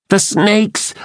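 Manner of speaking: high, alarmed shout; alarmed cry. A narrator's reading. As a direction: sound the alarm. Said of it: "I cried".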